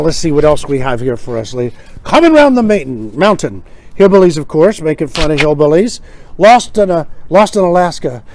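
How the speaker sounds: ordinary talk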